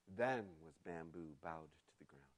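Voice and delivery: normal talking voice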